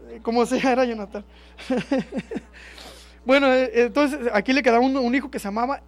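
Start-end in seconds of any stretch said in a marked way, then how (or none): 1.6-2.9 high, amused chuckling; laughing. Real, not acted. Preacher giving up on a name's pronunciation, amused laugh.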